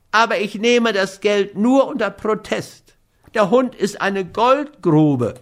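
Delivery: high, plaintive character voice; whiny dog owner, protesting. A narrator's reading. Schmidt takes the money under protest, whiny. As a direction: complain in a high voice while giving in.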